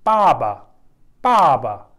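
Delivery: पाबा with the first "aa" pulled long, then falling; drawn-out A. Shown as a demonstration. Teacher showing how he pronounced the A, stretched "aa" in पाबा. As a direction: draw out the open "aa" in पाबा. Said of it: "लंबा खींच के मैं ए को पढ़ रहा हूँ".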